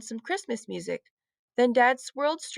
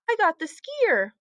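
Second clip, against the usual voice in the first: cheerful announce; 8 semitones higher, 3 dB louder than usual. A narrator's reading. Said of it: "Mom said".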